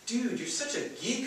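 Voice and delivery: high mocking teen voice; teasing buddy. Vinny teases his friend, high and mocking. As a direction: rib your geeky friend.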